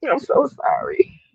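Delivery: tearful, voice breaking, pushing the words out; speaking through real tears; emotional, pitch lifting with tears; crying while talking. Real, not acted. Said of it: "I'm trying to push through".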